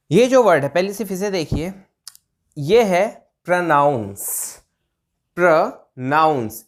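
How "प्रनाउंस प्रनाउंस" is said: slow प्रोनाउंस, pruh-NOUNS; correct pronunciation. Shown as a demonstration. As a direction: break प्रोनाउंस into short 'pruh' and drawn-out 'nouns'. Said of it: "इसको हम ऐसे बोलते हैं". (धीरे से 'प्र-नाउंस')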